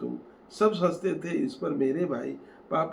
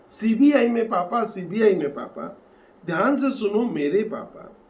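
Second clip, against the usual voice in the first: loud, higher exasperated call; angry shout; 5 semitones higher, 6 dB louder than usual. Acted. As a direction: shout it at a father who can't hear. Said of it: "मैंने उन पर जोर से चिल्लाया".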